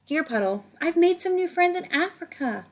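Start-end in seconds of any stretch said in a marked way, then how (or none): none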